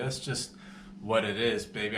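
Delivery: ordinary talk, nothing marked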